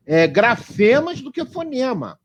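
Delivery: ordinary delivery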